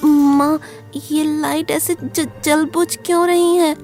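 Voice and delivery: put-on high, halting child voice with a stammer; scared kid calling out to his mother, then faltering; frightened boy. A narrator's reading. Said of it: "Kunal और घबरा जाता है". (डरे हुए बच्चे की हकलाती ऊँची आवाज़)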